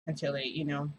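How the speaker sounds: ordinary talk, nothing marked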